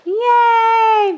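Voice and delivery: high, loud, drawn-out cheer; excited cheer. Real, not acted. Parent cheers Elise's effort, high loud cheer.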